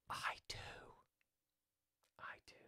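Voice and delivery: hushed confession, barely voiced; mock whisper. Streamer whispers to chat that he does swear. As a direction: whisper it like a guilty secret.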